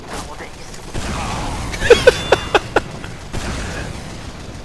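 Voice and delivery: long, high-pitched laughing fit; high cackle. Real, not acted. Gamer charging in with a teammate, high cackle. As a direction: cackle uncontrollably.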